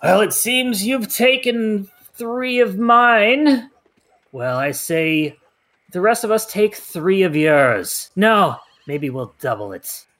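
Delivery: slow, loud booming villain voice. Acted. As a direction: boom it out slowly over everyone. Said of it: "you hear the booming voice".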